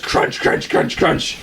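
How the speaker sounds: crunching footsteps made with the voice; slow, noisy crunches, barely voiced; imitated crashing through branches. Friend crashing through branches, imitated crunching. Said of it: "he's just crashing through branches".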